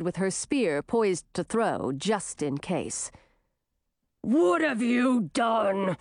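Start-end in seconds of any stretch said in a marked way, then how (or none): 4.2-6.0 slow, drawn-out beastly snarl; snarling werewolf. A narrator's reading. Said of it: "snarled the werewolf".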